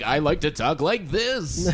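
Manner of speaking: slow, polished anchor delivery; news anchor voice. Retold or imitated. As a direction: read it like a stiff newsreader. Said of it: "a news anchor voice".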